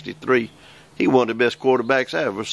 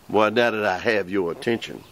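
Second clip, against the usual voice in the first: usual speaking voice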